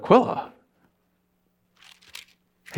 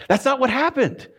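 normal talking voice

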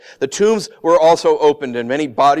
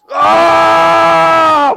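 loud cry out; 14 semitones higher, 11 dB louder than usual. Retold or imitated. Imitating the dying cry.

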